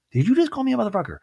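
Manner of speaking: fast, high-pitched mock disbelief; incredulous repeat. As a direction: imitate an affronted daughter, pitch shooting up. Shocked reply, repeated.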